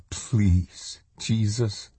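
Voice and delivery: understated, anguished plea; mild, pleading prayer. A narrator's reading. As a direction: pray it with a touch of anguish. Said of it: "A sob of anguish broke from her throat".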